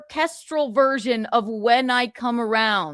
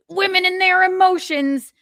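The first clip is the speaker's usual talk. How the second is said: higher, mocking voice of a bitter guy; mock whiny guy; 8 semitones higher than usual. Streamer mocking a sexist chatter with a higher whiny voice. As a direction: send up the commenter as a sulky man. Mocking a chat commenter's view.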